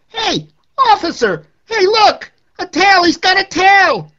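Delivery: very high, very loud alarmed shout; frantic shout of a passerby; shouting officer call. Acted. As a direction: yell it across the street to the police. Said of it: "when suddenly I hear".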